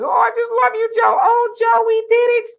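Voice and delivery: high, loud, sing-song fawning; mock gushing. Mimicking her gushing to Joe after their feud, mock-adoring. Mocks politicians now being affectionate.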